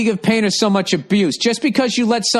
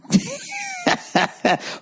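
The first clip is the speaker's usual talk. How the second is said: shrieking laugh; 5 dB quieter than usual. Real, not acted. Comedian laughing at his own insult, high shrieking laugh. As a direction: crack up with a high squeal.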